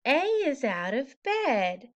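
plain reading voice

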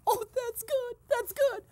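very high, giddy squeal; gleeful delight. Pretend. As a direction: relish the gossip in a high giddy voice. Delighted by overheard subpoena news.

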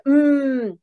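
long hummed 嗯 with lips shut; closed-lip sound. Shown as a demonstration. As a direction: demonstrate 嗯 as a high, sustained mmm. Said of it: "闭唇的音".

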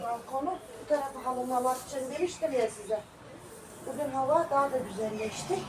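ordinary talk

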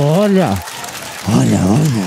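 low demon voice in the ear; low, drawn-out tempting whisper-rasp; Satanás. Acted. Satan tempting the son at his ear, low demon voice. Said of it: "o Satanás veio no meu ouvido e falou".

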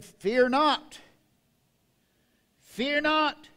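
loud, high ringing proclamation; booming heavenly announcement; proclaiming angel. Acted.

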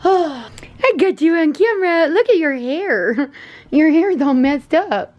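high, slow sing-song puppy talk. Real, not acted. Cooing at her puppy on camera.

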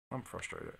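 soft, deflated I'm frustrated; quiet frustration. Pretend. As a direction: let it sag out.

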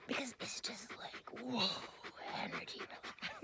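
soft breathy whisper as the dog; dog's inner voice. Acted. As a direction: whisper the dog's wise words. Voicing the dog.

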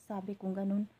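ordinary delivery

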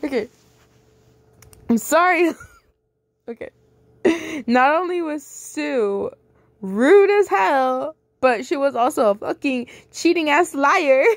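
stifled laughter; laughing through words. Real, not acted. Still laughing through narration.

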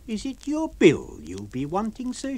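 cool, pointed question; Mrs Hall, sharp landlady. Acted. Landlady confronting the lodger about his bill.

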